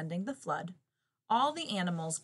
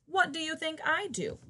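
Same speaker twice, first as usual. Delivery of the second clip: indignant bear; 10 semitones higher, 4 dB louder than usual. Acted. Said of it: "growled the bear".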